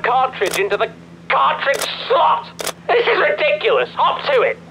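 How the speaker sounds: high-pitched exasperated ordering; losing patience. Acted. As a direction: scold and hurry them along, pitch climbing. Losing patience, ordering player to start.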